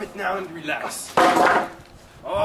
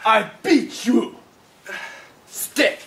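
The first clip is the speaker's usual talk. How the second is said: barking angry guard; 4 semitones higher than usual. Acted. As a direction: bark commands at the prisoner. Guard barks orders at the prisoner.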